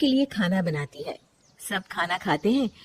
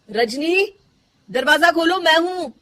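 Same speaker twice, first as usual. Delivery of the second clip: raised call with a rising end; 8 semitones higher, 5 dB louder than usual. Acted. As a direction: call her name loud and high through the door. Calling through closed door.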